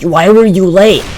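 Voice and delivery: high, loud mock scolding; going off. Teacher scolding student for being late, mock outrage. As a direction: imitate an outraged teacher demanding answers. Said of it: "she fucking goes off on me".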